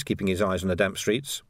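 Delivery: ordinary delivery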